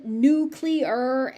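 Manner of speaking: "nuclear" drawn out as NOO-kyuh-ler; nonstandard pronunciation. Shown as a demonstration. Teacher warning of a common wrong form, "nucular" drawn out. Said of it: "even though it's wrong".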